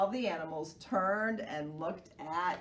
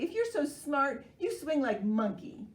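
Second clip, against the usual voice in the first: angrily; 6 semitones higher, 3 dB louder than usual. Acted. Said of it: "said Kangaroo angrily".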